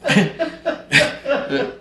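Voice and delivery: a long, high-pitched laugh that falls off. Real, not acted. Laughing with the host about Doug, high laugh.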